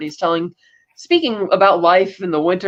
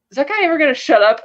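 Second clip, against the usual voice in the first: mildly exasperated, higher and quick; annoyed; 8 semitones higher, faster than usual. Retold or imitated. Doctor complaining about the groaning patient in the hallway, annoyed. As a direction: lightly mimic an irritated boss's quick complaint.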